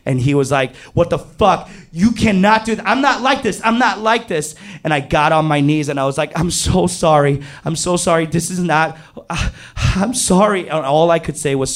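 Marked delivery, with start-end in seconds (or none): 0.9-4.5 very high, heated imitation of protest; frantic outrage. Friend smashing up the room, acted frantic outrage. 6.4-10.7 higher-pitched, pleading repetition; desperate apology. Retold or imitated. Storyteller on his knees before friend, acted desperate apology.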